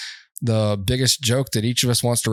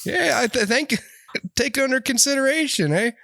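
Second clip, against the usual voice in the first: much higher polite Canadian; mock Canadian accent; 12 semitones higher than usual. Host riffing as a polite Canadian, high voice. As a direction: chirp it with an eh.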